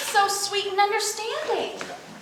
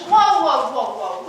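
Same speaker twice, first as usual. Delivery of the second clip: startled whoa; 6 semitones lower, 6 dB louder than usual. Acted. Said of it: "actor tries the directed whoa".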